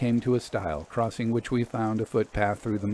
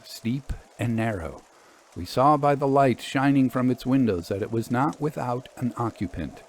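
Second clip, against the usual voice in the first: usual speaking voice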